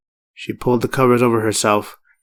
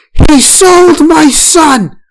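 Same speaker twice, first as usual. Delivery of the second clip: much higher, very loud, slow furious yell; furious snap; 9 dB louder, much slower than usual. A narrator's reading. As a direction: scream the grief out. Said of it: "Farah snapped".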